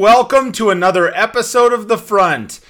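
loud upbeat host intro; energy drink ad. Acted. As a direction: pump up the show open. Host show opener.

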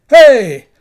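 loud shout into the empty courtyard; yelling. Acted. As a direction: call out to wake the whole place. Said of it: "walked around the deserted courtyard yelling".

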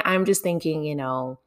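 normal talking voice

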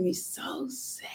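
hushed, drawn-out near-whisper; sad. Real, not acted. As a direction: let the voice drop away in sadness. Recalls grief over late husband.

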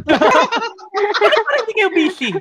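high, laughing teasing question; laughing tease. Real, not acted. Host laughing while teasing colleagues who aren't busy. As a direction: laugh it out as a playful jab.

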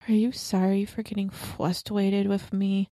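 a hint of a small boy asking; imitating her son. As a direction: ask it gently like a toddler. Mom retells son's question in car.

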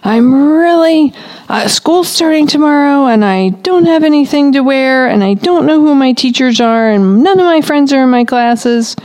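put-on high, slightly whiny child voice; upset child. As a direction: voice the worried kid before school. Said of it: "but maybe child says".

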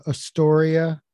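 normal talking voice

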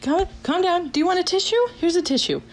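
high, flustered attempt at soothing; imitated panic. Professor calming the crying student, high and panicky. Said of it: "she started panicking too".

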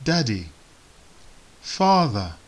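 usual speaking voice

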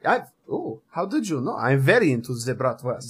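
put-on soft, coy German-accented delight. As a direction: confess it quietly in a thick German accent. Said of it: "impressions of my accent".

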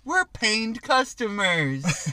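put-on slow, high baby talk; Rugrats baby voice. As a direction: drawl it like a cartoon toddler. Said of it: "something they would say on Rugrats".

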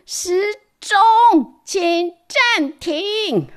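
high, slow, loud stretched cry to the clock; shout. Acted. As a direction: command the clock to stop. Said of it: "喊说：". (乔乔对时钟大喊，高亢而拉长)

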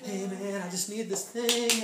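mock begging, words stretched out slowly; softly wheedle, dragging out the ask; put-on scrounger's drawn-out appeal; imitated wheedling plea. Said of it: "start scraping and try to manipulate".

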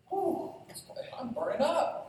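hammed-up gasp at the heat; mock overheated. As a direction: pitch jumps way up on "Whew". Overheating in the car.

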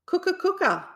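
high clucking hen call. A narrator's reading. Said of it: "clucked the hen".

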